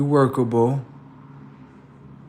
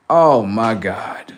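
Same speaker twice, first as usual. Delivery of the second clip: loud, slow, drawn-out dismay; re-enacted loud exasperation; exasperated outburst; 5 dB louder, much slower than usual. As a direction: let the disbelief burst out, stretching each word. Brother's exasperation grows.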